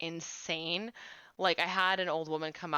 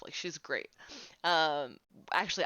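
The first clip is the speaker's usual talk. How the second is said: normal talking voice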